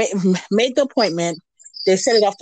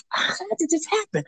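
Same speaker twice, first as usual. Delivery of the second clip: higher, softer disbelief; stunned; 10 semitones higher, 7 dB quieter than usual. Retold or imitated. Guest recalling her shock at the news, hushed and high. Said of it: "And I was like".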